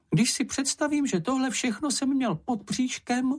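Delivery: very high squeaky creature, aghast; horrified Čenich. A narrator's reading. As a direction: squeak it out in horror. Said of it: "řekl Čenich a otřásl se hrůzou". (zděšené vysoké pištění)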